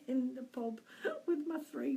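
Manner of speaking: real chuckle breaking up the words; laughing. Real, not acted. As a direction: crack up mid-sentence at a fond memory. Amused by memory of pub humour.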